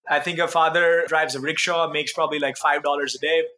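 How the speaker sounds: ordinary delivery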